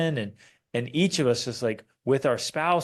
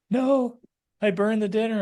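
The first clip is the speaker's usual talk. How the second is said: high, light mock wife's voice; imitating wife; 8 semitones higher than usual. Husband playfully imitates his wife explaining the burnt dinner, high voice. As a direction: pitch it up for the wife's answer.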